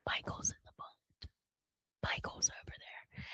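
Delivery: soft whispered warning; whispered alarm. Retold or imitated. Missus Brody warning her husband, whispered. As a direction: breathe it out in a whisper. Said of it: "Missus Brody's like".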